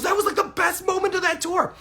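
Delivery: very high, loud excited yell; excited shout. Retold or imitated. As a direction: shout it out, thrilled. Friend excitedly recalls the moment.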